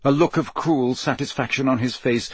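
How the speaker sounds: plain reading voice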